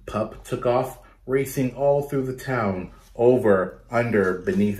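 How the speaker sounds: put-on lively, rhythmic read-aloud for kids; storytelling to children. Teacher reading a picture book to her class, lively read-aloud. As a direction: bounce the rhymes for a class of children.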